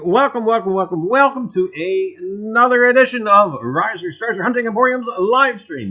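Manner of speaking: loud, upbeat host opening the stream; show host welcome. Acted. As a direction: greet the gang like a TV host. Opens the livestream show.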